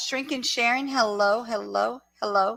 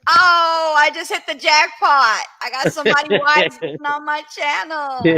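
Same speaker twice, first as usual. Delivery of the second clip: excited; 5 semitones higher, 8 dB louder than usual. Retold or imitated. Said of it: "I was like".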